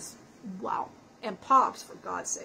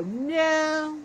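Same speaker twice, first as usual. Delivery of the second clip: slow, drawn-out refusal; much slower than usual. Retold or imitated. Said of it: "And I go".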